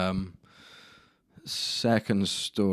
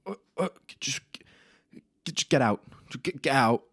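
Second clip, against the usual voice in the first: fuming teacher; 7 semitones higher than usual. Retold or imitated. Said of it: "He was like".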